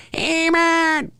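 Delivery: high put-on Skeletor greeting; hint of Skeletor. Streamer replying to chat in a lightly voiced Skeletor. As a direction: greet like a goofy cartoon villain. Skeletor voice again after laugh.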